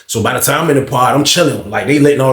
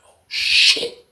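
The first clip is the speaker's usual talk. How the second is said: whispered; 4 dB quieter than usual. Retold or imitated. Homeboy reacting under his breath.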